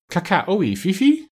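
high parrot call, rising sharply at the end; high-pitched bird-speech question; bird language. Acted. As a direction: imitate bird talk in a high voice. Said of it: "in bird language".

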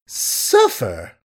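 drawn-out mocking echo, swooping high on I; mock him with a slow, incredulous lilt; languid mockery. A narrator's reading. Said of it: "she mocked him languidly".

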